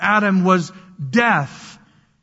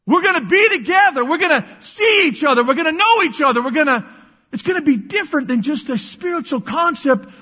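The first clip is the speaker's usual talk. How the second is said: excited preaching; 7 semitones higher than usual. Real, not acted. Preacher excited about heaven together.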